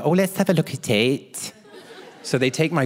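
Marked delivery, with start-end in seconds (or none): 0.0-1.5 put-on fussing nurse voice; hammed-up nurse inspecting a patient; imitated set nurse. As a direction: coo it like a doting nurse. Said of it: "And she goes".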